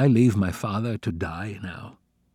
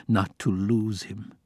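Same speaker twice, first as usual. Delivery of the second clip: ordinary delivery, nothing marked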